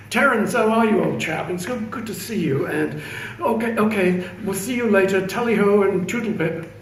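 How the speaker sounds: put-on an American overdoing a plummy upper-class English accent; mock upper-class English accent. Manager greeting Terence at work in a mocking upper-class English accent. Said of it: "in this upper, in, uh, upper class English accent".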